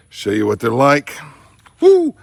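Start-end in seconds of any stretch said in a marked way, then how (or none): 1.8-2.1 high woo from the heat; hot food yelp. Real, not acted. Hot peanut in mouth.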